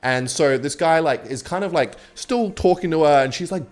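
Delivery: ordinary delivery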